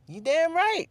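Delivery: very high, slow mocking squeak; sing-song it like a smug sidekick; mock boast. Vlogger mocking herself as his helpmate, very high and slow.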